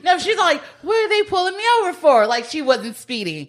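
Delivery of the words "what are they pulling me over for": high, fast fake innocence; mock innocent. Driver acting clueless to the cops, high and fast. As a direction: play dumb about the cops, quick and high.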